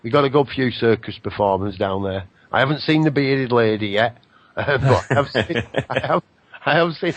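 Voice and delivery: usual speaking voice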